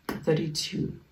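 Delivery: ordinary talk, nothing marked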